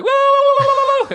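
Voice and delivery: very high whooping woos; naked fan whooping. Retold or imitated. Imitating a naked fan whooping through the crowd. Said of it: "He was like".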